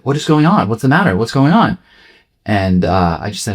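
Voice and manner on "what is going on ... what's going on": higher, urgent questions from the dad; worried dad. Retold or imitated. Dad sees his crying son, higher and urgent. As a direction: ask like an alarmed father. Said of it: "He's like".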